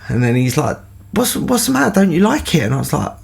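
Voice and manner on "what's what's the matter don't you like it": high-pitched puzzled questioning; Mister Gill. Retold or imitated. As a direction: imitate a baffled teacher, voice climbing. Teacher puzzled at his reluctance.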